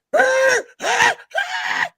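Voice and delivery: high, loud yelping dog cry; imitated dog scream. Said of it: "heard a dog scream".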